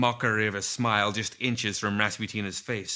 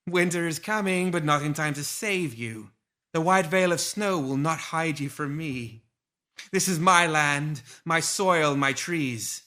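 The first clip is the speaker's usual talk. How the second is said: higher, otherworldly seductive taunt; seductive villainess; 6 semitones higher, 4 dB louder than usual. A narrator's reading. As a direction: Lilith taunting Rasputina as a seductive voice. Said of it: "a seductive voice laughed softly".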